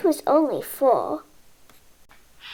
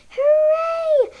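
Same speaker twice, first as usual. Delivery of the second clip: much higher, louder hooray with a laugh; excited cheer; 12 semitones higher, 7 dB louder than usual. A narrator's reading. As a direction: burst out cheering with joy. George finally tall enough.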